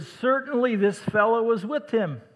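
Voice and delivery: high, loud scornful accuser in the courtyard; accusing bystander. Acted. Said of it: "another, uh, asserted".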